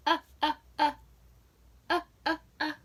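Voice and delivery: punched repeated "Uh, uh, uh" as in hug; short u sound. Shown as a demonstration. Teacher modelling the "Uh" vowel in hug. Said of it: "What short vowel sound".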